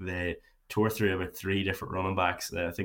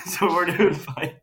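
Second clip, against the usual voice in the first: laughing; 11 semitones higher, 8 dB louder, slower than usual. Real, not acted. Laughing at the bad connection.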